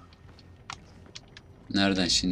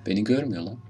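normal talking voice

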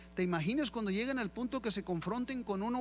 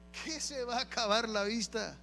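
a hint of mock disbelief, different voice; incredulous retort. Preacher scoffing at the child's excuse, incredulous. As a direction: toss it back in disbelief.

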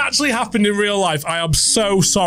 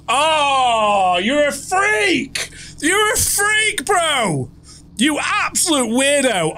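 high, slow, stretched-out insult; disgusted squeal; 8 semitones higher, much slower than usual. Real, not acted. Creeped out by the passenger.

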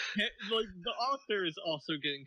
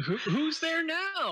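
mock startled; 8 semitones higher, slower than usual. Playing Link, startled.

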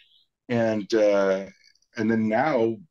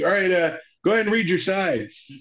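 higher-pitched imitated director; brisk retold instruction in a raised pitch; director's instruction; 9 semitones higher than usual. Director sending the kid to read, businesslike.

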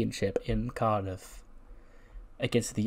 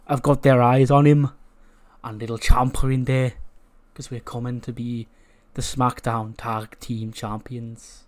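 hammed-up Scouse with slow, drawn-out words; slow Scouse threat, loudest on the team name; attempted Scouse accent; 5 dB louder than usual. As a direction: put on a rough Scouse accent, stretching the words. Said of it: "I can't do my Scouse accent".

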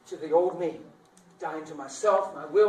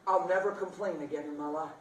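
usual speaking voice

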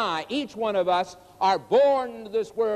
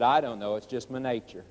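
low, fading, offhand answer; a lightly voiced scorpion, unbothered; scorpion's shrug; 8 semitones lower than usual.

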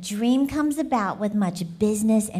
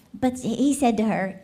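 usual speaking voice

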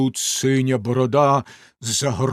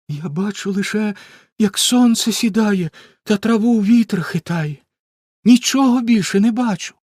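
sister's lighter voice; 8 semitones higher, 5 dB louder than usual. A narrator's reading. Sister Anna answers.

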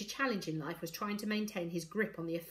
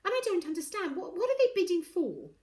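put-on high girlish voice, insistent; puzzled child; 11 semitones higher, 5 dB louder than usual. A narrator's reading. Girl asks adults about bidding, puzzled. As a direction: press the question as a confused child. Morrigan's voice in story.

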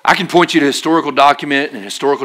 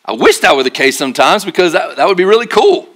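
ordinary delivery

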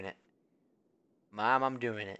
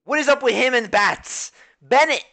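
angry yell; 8 semitones higher, 10 dB louder than usual. Real, not acted. Streamer raging at game.

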